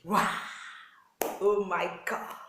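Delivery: loud, acted wow of amazement; amazed surprise. Husband opening his eyes to a surprise, amazed. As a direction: exclaim loudly, as if shown a gift.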